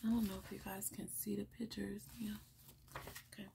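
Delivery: quick hushed whisper aside. Real, not acted. Reader checking the kids can see the pictures, in a quick whisper. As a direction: drop to a whisper and hurry through it. Aside to viewers mid-story.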